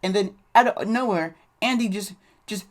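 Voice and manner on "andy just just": ordinary delivery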